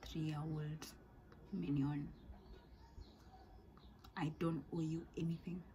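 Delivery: soft unvoiced aside; whisper. Real, not acted. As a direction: hiss it under your breath. Scolding kids about sharing.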